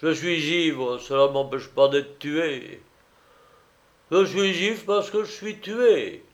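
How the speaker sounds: high, insistent tipsy lament; drunken master, self-pitying. A narrator's reading. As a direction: argue your ruin with boozy logic.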